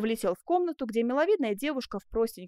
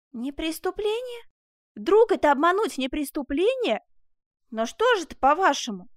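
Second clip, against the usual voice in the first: high, incredulous protest growing loud; outraged disbelief; 6 semitones higher, 6 dB louder than usual. Acted. Prisoner argues with jailer who shut him out, outraged. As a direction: throw the question back, indignant.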